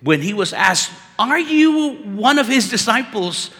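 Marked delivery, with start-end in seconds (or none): 1.2-3.5 high, loud challenge to a suspect; pointed questioning. Acted. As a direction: put him on the spot, voice raised. Peter questioned by his accusers.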